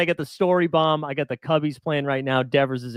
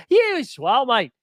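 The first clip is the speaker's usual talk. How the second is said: higher, hammed-up Irish accent; retelling a failed Irish accent, pitched up; bad Irish accent; 10 semitones higher, much slower than usual. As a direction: mimic an Irish local, embarrassingly. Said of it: "do an Irish accent sometimes".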